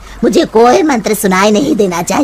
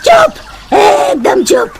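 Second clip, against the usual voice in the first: sharp command; 12 semitones higher, slower than usual. Acted. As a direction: shut him up at once. Witch silences the priest.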